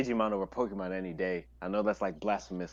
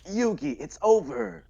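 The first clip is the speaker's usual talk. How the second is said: Kaiba taunt; 8 semitones higher, slower than usual. Retold or imitated. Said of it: "But, like".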